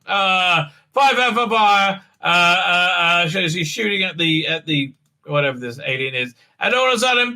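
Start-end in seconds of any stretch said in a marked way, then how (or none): none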